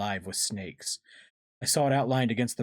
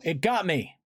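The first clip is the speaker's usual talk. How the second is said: high, alarmed yell; yelled cry; 9 semitones higher, 4 dB louder than usual. A narrator's reading. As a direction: cry out as you are caught. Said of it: "I heard Marco yell".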